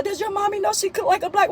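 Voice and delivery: a light, playful impression of a giggly girl; imitating Chelsea. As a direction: Chelsea repeating Jazzy's joke to her mother. Retelling the friend repeating her joke.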